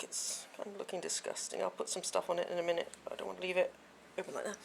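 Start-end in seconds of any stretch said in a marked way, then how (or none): 0.0-2.6 soft whispered aside. Real, not acted. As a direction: hush it, a bit grossed out.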